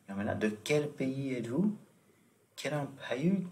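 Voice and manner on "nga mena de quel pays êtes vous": ordinary delivery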